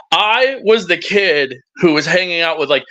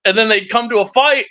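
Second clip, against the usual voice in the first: ordinary talk, nothing marked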